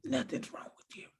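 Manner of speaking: soft unvoiced whisper of a sulky wife; whispered mock denial. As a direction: breathe it out like a sullen aside. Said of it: "But you say".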